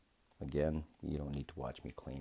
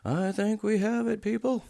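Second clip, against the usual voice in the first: much higher and louder, thrilled announcement; triumphant high shout; 11 dB louder than usual. Real, not acted. Watchmaker celebrating to his viewers, triumphant high shout. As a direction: cry out the success to the viewers. Rotor cover finally fitted.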